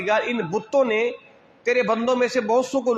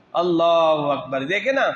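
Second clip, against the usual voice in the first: ordinary talk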